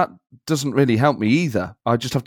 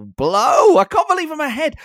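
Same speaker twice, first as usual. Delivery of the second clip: exultant yell; 5 dB louder than usual. Acted. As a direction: crow it in high, disbelieving triumph. Crowing about being ahead in the contest.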